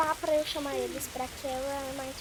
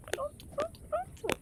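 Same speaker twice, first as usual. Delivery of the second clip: excited relief. Real, not acted. Relief after the kill.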